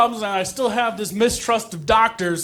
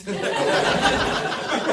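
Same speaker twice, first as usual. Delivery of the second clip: crowd laughter; 4 semitones higher, 3 dB quieter than usual. Real, not acted. Audience laughs at joke.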